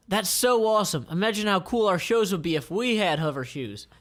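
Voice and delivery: high, gushing delight; excited bandmate. Acted. Bandmate dreaming of hover shoes, gushing. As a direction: gush over the hover shoes. Band member voiced excitedly.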